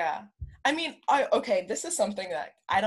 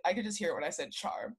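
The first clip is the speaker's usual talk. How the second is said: ordinary talk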